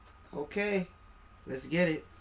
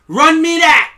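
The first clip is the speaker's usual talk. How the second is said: even louder and higher; louder demand; 9 semitones higher, 17 dB louder than usual. Acted. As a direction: push the demand harder. Repeated demand, escalated.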